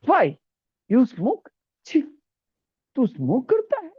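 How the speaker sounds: high, shocked reproach; shocked scolding. Acted. Teacher playing an elder shocked at a smoking boy. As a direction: catch him smoking.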